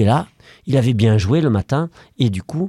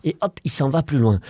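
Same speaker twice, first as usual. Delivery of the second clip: ordinary delivery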